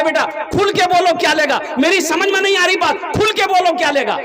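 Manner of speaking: fed-up demand to speak plainly; irritated bride's father pleading with the silent groom; exasperated father. Retold or imitated. गुस्सा आ गया.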